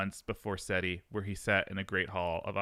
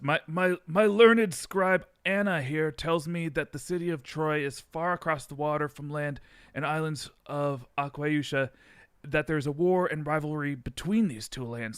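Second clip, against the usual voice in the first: lightly higher pharaoh voice slipping toward surfer; pharaoh drifting surfer; 5 semitones higher, 4 dB louder than usual. Acted. Narrator voicing Seti about Troy, accent drifting into a mild surfer voice. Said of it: "my Seti is turning surfer".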